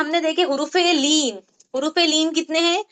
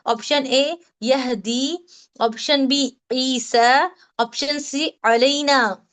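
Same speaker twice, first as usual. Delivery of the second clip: slow quiz reading; much slower than usual. Shown as a demonstration. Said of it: "quiz options read slowly".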